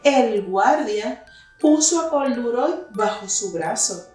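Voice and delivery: ordinary delivery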